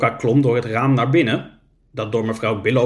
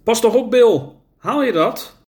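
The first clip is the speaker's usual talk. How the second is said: higher, louder warning call to a friend up a ladder; man calling out; 9 semitones higher, 3 dB louder than usual. Acted. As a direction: call up to Bill in alarm. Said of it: "hoorde roepen".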